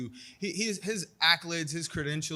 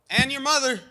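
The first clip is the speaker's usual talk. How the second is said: loud, high teasing jab; cheeky jab; 11 semitones higher, 11 dB louder than usual. Real, not acted. Fighter jabs back at the interviewer, loud and teasing. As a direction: throw a playful insult, louder and higher.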